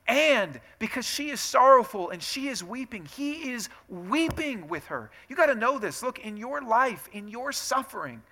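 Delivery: high, ringing, drawn-out emphasis; impassioned preaching. Real, not acted. Preacher assuring sufferers that Jesus weeps with them, impassioned. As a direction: lift the voice and press each phrase home. Preaches climax on shared grief.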